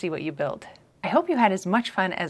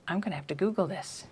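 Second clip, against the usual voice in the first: ordinary delivery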